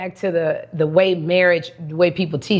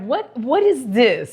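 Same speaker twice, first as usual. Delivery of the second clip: slow, higher-pitched mock exasperation; imitated irritation; 4 semitones higher, slower than usual. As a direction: imitate an annoyed date, drawing out the words. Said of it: "He was so irritated".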